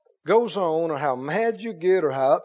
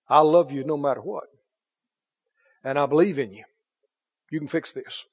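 tender reassurance; 2 semitones lower than usual. Retold or imitated. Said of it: "you're still ready to tell them".

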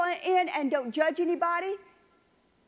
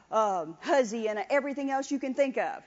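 ordinary delivery

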